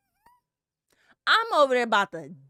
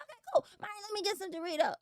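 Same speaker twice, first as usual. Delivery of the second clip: eager high mock voice; 10 semitones higher, 7 dB quieter, much faster than usual. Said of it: "So I'm like".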